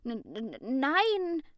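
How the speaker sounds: stammered start, then a sudden high nein; stuttering denial. A narrator's reading. Lukas denies eating the cake, stuttering nervously. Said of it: "verteidigte er sich stotternd".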